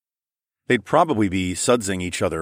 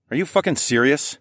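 higher incredulous question; scornful snort; 7 semitones higher than usual. A narrator's reading. Narrator answers the older woman's question, scornful. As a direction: ask it in scornful disbelief. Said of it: "I snorted".

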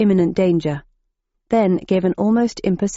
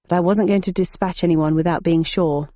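ordinary delivery, nothing marked

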